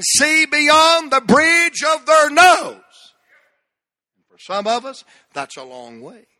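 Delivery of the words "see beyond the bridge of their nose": loud, high, drawn-out cry; preaching shout. Real, not acted. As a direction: belt out the punchline to the congregation.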